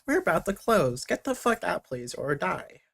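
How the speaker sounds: lightly voiced tannoy announcer, creepy ending; intercom voice. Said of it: "a little intercom voice".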